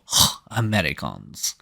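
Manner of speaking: low, soft mock scoff; twin sisters' scoff. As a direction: sigh it out in mock disdain. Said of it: "they go".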